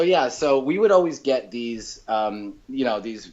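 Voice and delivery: ordinary talk, nothing marked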